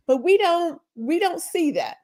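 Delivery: ordinary delivery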